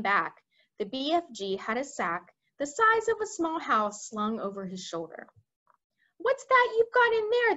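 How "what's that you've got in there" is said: much higher, louder blustering general; demanding army head. Acted. Head of the army demanding to see the BFG's sack, high and loud. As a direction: bark it out as a pompous officer. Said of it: "demanded to know".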